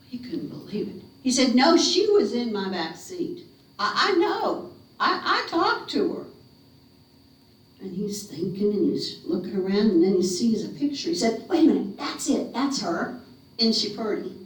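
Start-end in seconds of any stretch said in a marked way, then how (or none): 1.5-6.2 a hint of disbelieving driver. 11.5-14.3 mild excited recognition. Acted. Driver recognizes photo.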